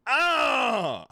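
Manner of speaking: sudden high pained scream; pained yelp. Real, not acted. Streamer yelps at a painful sound alert, loud scream. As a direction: yelp as the sound hits hard. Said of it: "That fucking hurts".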